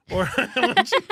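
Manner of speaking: cracking up mid-sentence; laughing. Real, not acted. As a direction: laugh through the words at your own joke. Laughing at own joke.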